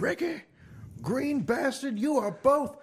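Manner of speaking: very high-pitched put-on referee voice; mock referee ruling. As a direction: announce the disqualification in a high, loud cartoonish voice. Acting out the ref's disqualification.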